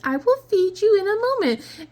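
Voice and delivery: high, sing-song talk to the cat; high pet voice. Acted. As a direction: coo at a pushy pet. Covering phone, talking to cat.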